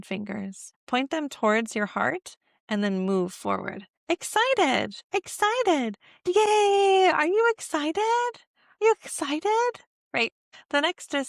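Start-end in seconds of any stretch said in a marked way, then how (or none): 4.1-9.7 very high, bouncy excited voice; high cheering yay to a toddler; excited baby talk. Shown as a demonstration. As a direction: cheer like the baby's thrilled.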